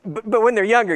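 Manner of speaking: normal talking voice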